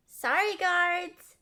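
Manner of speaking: slow, loud call back over the shoulder; cheeky call to the guards. Acted. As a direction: shout an apology while fleeing. Calling back while running away.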